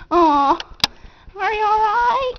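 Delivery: high, loud coo to a pet; sweet concerned pet talk. Real, not acted. Comforting the hiccuping dog.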